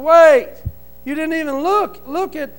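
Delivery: loud, desperate cry to the banker; begging outburst. Retold or imitated. To the banker refusing the loan, loud desperate begging. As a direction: plead hard, voice jumping up. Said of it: "and begged him".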